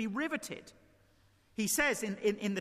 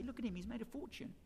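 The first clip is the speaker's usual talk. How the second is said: much softer, murmured to the person beside you; hushed aside; 2 semitones higher, 11 dB quieter, faster than usual. Retold or imitated. Commuter nudging his neighbour on the train, murmured softly. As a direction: lean over and say it under your breath. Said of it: "you'd nudge your neighbour and say".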